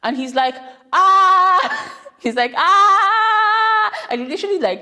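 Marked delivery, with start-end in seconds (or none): 0.9-1.9 a sudden high, loud scream; child's scream. Retold or imitated. A little boy screaming into the mic for his parents. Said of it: "screams on the mic". 2.6-3.9 a sudden high, loud scream; child's scream. Retold or imitated. A little boy screaming into the mic for his parents. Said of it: "screams on the mic".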